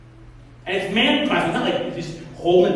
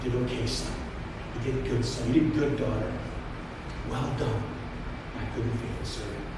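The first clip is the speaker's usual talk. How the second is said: tender master's praise; 7 semitones lower, 8 dB quieter, faster than usual. Retold or imitated. Master softly blessing his faithful servant, tender. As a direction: speak it gently like a proud father.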